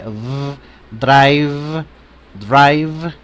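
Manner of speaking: drive, let the v vibrate; vibrating v. Shown as a demonstration. Teacher showing the buzzing v. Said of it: "hagamos vibrar esta V".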